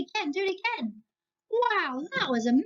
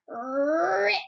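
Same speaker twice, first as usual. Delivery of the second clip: rip; 2 semitones higher than usual. Shown as a demonstration. Children ripping paper on cue.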